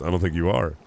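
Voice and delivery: usual speaking voice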